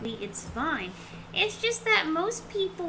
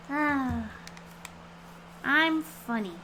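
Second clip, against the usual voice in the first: usual speaking voice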